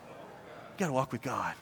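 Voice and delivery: low, soft preacher voice; hushed solemnity. Real, not acted. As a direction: drop low and quiet to let it sink in. Solemn repeat of key point.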